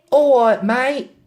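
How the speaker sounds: high, loud London greeting; put-on London accent, pitched right up. London girl greeting the taxi driver, put-on London accent. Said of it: "have a go at the accents".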